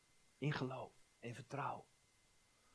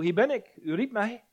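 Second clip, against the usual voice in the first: eager answer; 8 semitones higher, 7 dB louder than usual. Acted. Boy Samuel runs to Eli.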